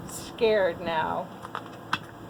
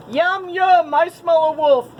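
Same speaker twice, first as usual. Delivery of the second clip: mock monster; 6 semitones higher, 6 dB louder than usual. A narrator's reading. Friends pretend to be monster.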